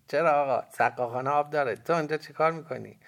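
put-on high, loud boy's retort; cheeky boy. A narrator's reading. Boy answers back in story.

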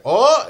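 sudden high, loud "oh"; imitated startled cry. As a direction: burst out with a loud mimicked cry. Imitates the creature's roar on noticing.

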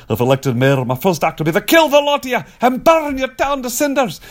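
hammed-up Scottish accent climbing very high; Groundskeeper Willie. Actor mimicking a cartoon for the interviewer, ranting Scottish accent. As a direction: rant in a cartoonish Scottish accent, pitch soaring. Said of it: "groundskeeper Willie from".

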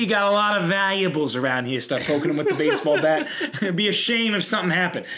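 hammed-up Brooklyn mobster accent; Brooklyn tough guy. Mobster demanding protection fee, in a hammed-up Brooklyn accent. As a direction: play a Brooklyn heavy enjoying the shakedown. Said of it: "I'm going to Brooklyn accent".

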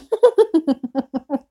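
high, loud burst of giggles; helpless high-pitched laugh; laughing at own joke. Real, not acted. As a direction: crack up at your own joke.

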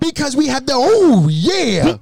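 high, hyped lead-in to a catchphrase; excited buildup, pitched well up; overexcited. Real, not acted. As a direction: get carried away with excitement. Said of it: "You got excited".

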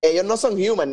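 usual speaking voice

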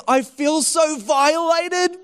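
high, slow wail of mock outrage; hammed-up wounded cry; worked up. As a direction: imitate a distraught victim, drawing it out. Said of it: "He was all worked up".

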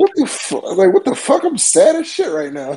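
high exasperated what the fuck; hammed-up disbelief at sad music; mock dismay. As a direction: play up the shock at being bummed out. Retelling reaction to sad songs.

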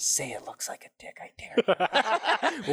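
menacing whisper, unvoiced; whispered threat. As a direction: hiss the dare into his ear.

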